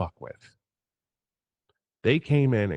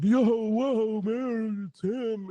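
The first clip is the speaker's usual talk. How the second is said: high, mocking starstruck whisper of awe; mock starstruck gasp; 12 semitones higher, much slower than usual. Mimicking partygoers gushing over the celebrity, high mock awe. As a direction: ape the gushing partygoers, pitched way up. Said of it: "people were like".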